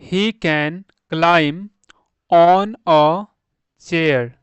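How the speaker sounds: word by word, slowly and loudly, pauses between; slow model reading. Shown as a demonstration. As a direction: read it out slowly for beginners. (धीरे-धीरे, शब्द-दर-शब्द पढ़ना)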